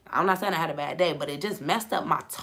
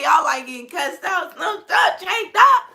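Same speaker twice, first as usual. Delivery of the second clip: building higher and louder with each phrase; teasing; 12 semitones higher, 7 dB louder than usual. Real, not acted. Teasing raunchy viewers, rising louder and higher. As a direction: climb up in pitch, scandalised glee.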